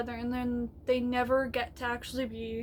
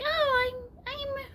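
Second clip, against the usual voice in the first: much higher, fast squeaky gnome voice; tiny excited gnome rambling; 9 semitones higher than usual. Shown as a demonstration. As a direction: chatter like a flustered little gnome. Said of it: "this is my gnome voice".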